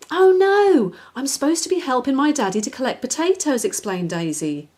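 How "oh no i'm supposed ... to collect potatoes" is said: flustered. Acted. Daisy panics at Daddy's call.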